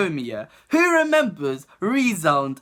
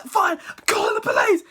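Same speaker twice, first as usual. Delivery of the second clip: high clipped threat; hammed-up teacher ultimatum; mock stern teacher; 14 semitones higher than usual. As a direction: dare him, pitched up.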